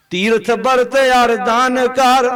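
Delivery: slow, drawn-out sacred verse; open-voiced verse recitation. Shown as a demonstration. Preacher leading the congregation in a shlok, slow open recitation. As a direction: stretch each word for the congregation to follow. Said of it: "ਸ਼ਲੋਕ ਖੋਲ ਕੇ ਬੋਲੋ".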